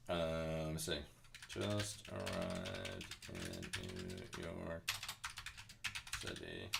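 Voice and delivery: low, slow murmur while searching; half-whispered, reading under the breath; muttered to self. Real, not acted. Streamer muttering the clip title to herself, quiet and slow.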